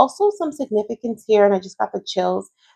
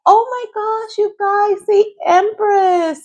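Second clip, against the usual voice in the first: high-pitched, delighted exclamation; excited reaction; 13 semitones higher, slower than usual. Real, not acted. Tarot reader reveals the Empress to viewers, very excited. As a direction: burst out high with real excitement.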